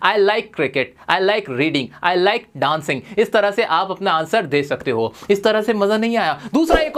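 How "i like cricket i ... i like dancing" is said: stretched very slowly, each word apart; slow model phrase. Shown as a demonstration. Teacher modelling a new phrase for students, very slow. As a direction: draw the phrase out for the class. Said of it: "मुझे मजा आता है".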